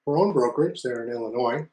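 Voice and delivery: ordinary talk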